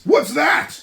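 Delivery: loud, high, sharp snort; snorting king. Acted. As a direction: scoff at the rising moon. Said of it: "snorted Yertle".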